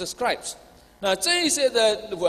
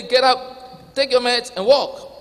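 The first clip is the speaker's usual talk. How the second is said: loud, high-pitched command; emphatic acted call to rise; 6 semitones higher, 6 dB louder than usual. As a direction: order it forcefully, raised well above normal talk. Acts out Jesus commanding the paralytic.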